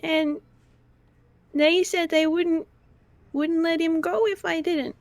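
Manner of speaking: put-on a guilty child defending herself; wide-eyed little girl protesting; Missy the little girl. Player voices Missy.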